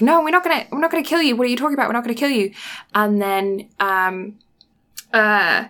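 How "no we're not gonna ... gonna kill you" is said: Julius refusing to kill Subaru, a pleading outcry; mock distraught protest. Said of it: "Julius is like".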